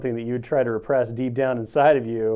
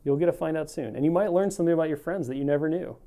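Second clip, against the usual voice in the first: ordinary delivery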